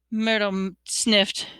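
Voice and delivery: ordinary delivery, nothing marked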